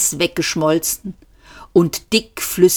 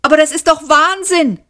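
high, raised protest; crying out; 12 semitones higher, 3 dB louder than usual. A narrator's reading. Trillian protesting to the police, called out. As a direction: call out in disbelief. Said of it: "rief Trillian".

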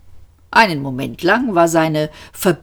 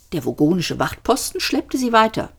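ordinary delivery

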